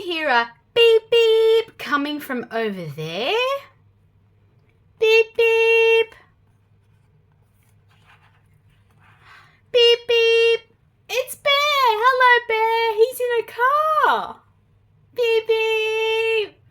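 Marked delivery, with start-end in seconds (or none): 5.0-10.6 high, louder beep beep; car horn. Acted. Playing the car horn for a child, high beeps. 11.1-16.6 high, bright greeting to Bear; happy discovery. Acted. Finding Bear in the car, a high happy greeting.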